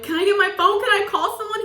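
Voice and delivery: high, anxious pleading. Retold or imitated. To the arresting officer, high anxious pleading. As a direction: beg like a scared kid.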